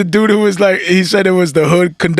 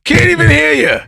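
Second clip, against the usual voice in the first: sarcastic; 8 semitones higher than usual. Acted. Sarcastic praise of the mic.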